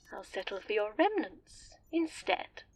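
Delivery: ordinary talk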